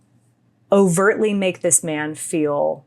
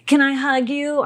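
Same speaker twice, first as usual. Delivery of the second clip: mock pleading man; 8 semitones higher than usual. Retold man asking for hug.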